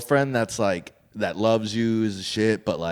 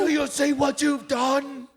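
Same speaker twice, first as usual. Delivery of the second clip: high, slow tragic whine; mock melodrama; 13 semitones higher, slower than usual. Friend joining the bit, high slow mock tragedy. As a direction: overplay the heartbroken accuser.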